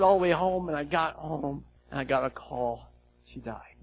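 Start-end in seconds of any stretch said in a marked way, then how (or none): none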